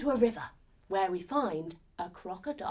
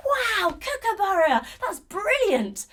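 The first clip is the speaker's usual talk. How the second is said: high, delighted exclamation; excited praise; 9 semitones higher, 3 dB louder than usual. Acted. Praising children after their song, excited. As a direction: cheer them on, drawing out the last word.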